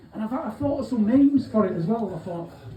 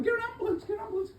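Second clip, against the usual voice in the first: panicked crowd; 12 semitones higher, 6 dB quieter, much faster than usual. Retold or imitated. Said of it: "all screaming".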